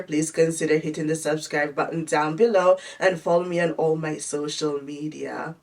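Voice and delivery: normal talking voice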